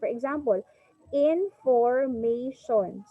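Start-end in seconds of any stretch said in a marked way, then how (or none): 1.1-3.1 "information" very slowly, syllable by syllable; slow teacher's count through each syllable of "information"; chopped into syllables. Shown as a demonstration. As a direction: break "information" into in, for, ma, tion. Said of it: "you have to chop".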